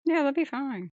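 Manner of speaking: high, dry mock reassurance; sarcastic. Co-host mocking the crew's competence, high sarcastic reassurance. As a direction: pretend it's all fine while meaning the opposite.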